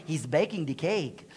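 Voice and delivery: low Spanish-accented impression; Marcial, Spanish accent. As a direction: put on a Spanish pastor's accent. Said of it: "As our Spanish pastor Marcial would say".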